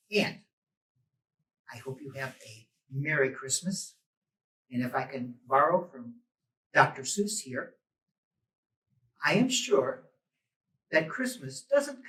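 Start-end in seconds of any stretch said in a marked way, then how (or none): none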